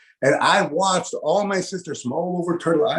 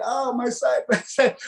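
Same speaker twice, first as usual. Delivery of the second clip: aching laughter; 6 semitones higher than usual. Retold or imitated. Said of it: "they grab their side".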